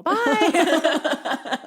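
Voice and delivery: high, loud sung-out goodbye; drawn-out comic farewell; mock cheery sign-off. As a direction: imitate nan fleeing the conversation. Retold nan ending the talk.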